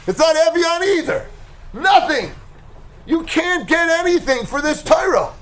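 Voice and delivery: hammed-up furious complaint to a father; angry son. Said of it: "ran back to his parents so angry".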